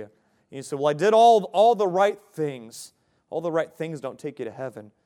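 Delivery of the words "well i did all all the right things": louder, higher self-justifying protest; indignant plea, mildly hammed-up; pleading protest. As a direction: protest your case, voice raised, slowing at the end. Acted protest to the Lord.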